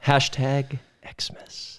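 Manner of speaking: HASHTAG voiced, then XMAS in a hissy whisper; whispered hashtag slogan. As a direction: drop into a long whisper on Xmas. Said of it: "Why are you saying it like that?".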